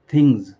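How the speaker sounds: थिंग्स ending with a buzzing z; स्पष्ट ज़ वाली ध्वनि में थिंग्स; z sound ending. Shown as a demonstration. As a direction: say थिंग्स with a voiced z at the end. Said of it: "ये है जेड sound".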